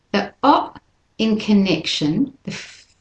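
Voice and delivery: usual speaking voice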